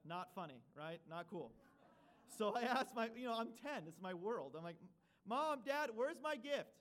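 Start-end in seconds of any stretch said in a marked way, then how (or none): 5.3-6.7 put-on high, loud childish plea; whiny kid demand. Boy asking his parents at Christmas, loud whiny plea. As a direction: whine like a ten-year-old wanting his present.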